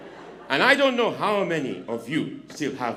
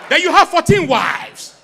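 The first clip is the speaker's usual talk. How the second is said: put-on slow, loud, high African accent; 12 semitones higher, 9 dB louder, slower than usual. Comic quizzing the volunteer in an African accent. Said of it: "in an African accent".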